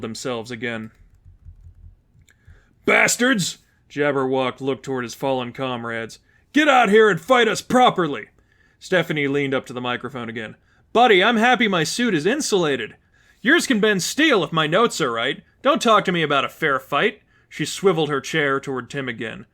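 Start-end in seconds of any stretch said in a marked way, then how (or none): 2.9-3.6 furious curse. Acted. Villain sees comrades fall. 6.5-8.3 higher, louder furious demand; angry challenge. Acted. Villain challenges hidden foes. 10.9-17.2 higher, louder teasing taunt; cocky young heroine. Acted. Stephanie taunts villain over mic.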